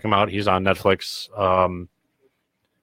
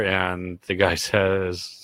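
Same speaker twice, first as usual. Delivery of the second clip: usual speaking voice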